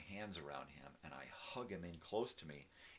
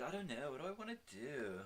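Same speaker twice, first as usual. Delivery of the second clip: dog's voice; 10 semitones higher, 4 dB louder than usual. Pretend. Voicing the dog's thoughts.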